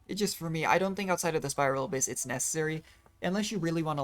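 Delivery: ordinary delivery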